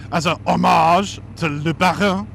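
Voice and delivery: mock outrageous French accent, slow; hammed-up French accent on the name; ridiculous French accent. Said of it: "that was Baron with a ridiculous accent".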